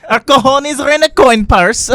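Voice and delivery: put-on high, loud comic foreign accent; Borat. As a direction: declare it as a hammed-up Borat. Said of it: "This message brought to you by Borat".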